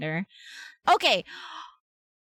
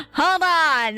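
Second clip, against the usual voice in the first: raised, higher "hold on" with a lift of urgency; excited call-out; 8 semitones higher, 5 dB louder than usual. Real, not acted. Streamer to chat as the page loads, excited call-out. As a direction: call it out high and loud.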